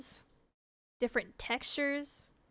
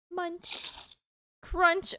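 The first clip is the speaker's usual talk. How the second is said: crunchy eating sounds; 7 semitones higher than usual. A narrator's reading. Narrator voices the cat eating.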